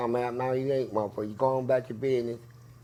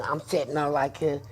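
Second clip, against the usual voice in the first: ordinary talk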